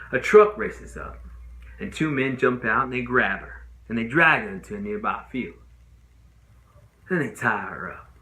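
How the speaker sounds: ordinary talk, nothing marked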